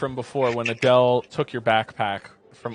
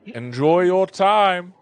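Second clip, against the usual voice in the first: higher, louder, drawn-out sing-out; knowing servant; 5 semitones higher, 5 dB louder, much slower than usual. Acted. Servant leaving Leo and Adele alone, knowing. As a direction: call it out slowly, rising.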